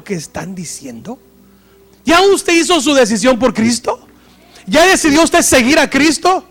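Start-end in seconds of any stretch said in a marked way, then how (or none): 2.0-6.4 much higher and louder, shouting the questions; fervent preaching shout. Real, not acted. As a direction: call them out to decide. Preacher challenging the congregation. (predicador gritando con fervor a la congregación)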